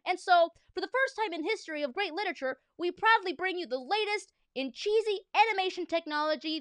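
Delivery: high, bright announcer read. Acted. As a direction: present it like an infomercial. Reads instructions as announcer.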